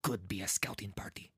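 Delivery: quick, soft character mutter; hushed worry. Acted. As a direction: think aloud, fast and very quiet. Man thinks aloud about intruders.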